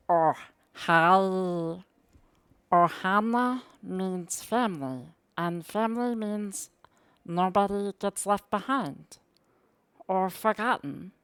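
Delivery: high, slow cartoon alien voice; Stitch. Shown as a demonstration. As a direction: stretch the words out in a little creature's voice. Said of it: "I can talk in that voice".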